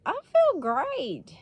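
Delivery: high, slow, sing-song "I feel great"; mock cheery high voice. Answering the nurse in a mock cheery high voice. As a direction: play it falsely chipper and high. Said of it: "And I'm like".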